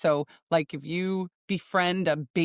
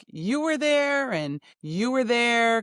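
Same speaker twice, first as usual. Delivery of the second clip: higher, slow, drawn-out dreamy voice; dreamlike quote; 6 semitones higher, slower than usual. Retold or imitated. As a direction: imitate dreamy, wondering recognition, stretching each phrase. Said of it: "that dreamlike ... kind of energy".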